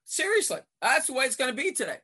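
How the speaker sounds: loud, high, quick gripe; exasperated complaint. Retold or imitated. Coach griping at the referee next time down the floor, exasperated.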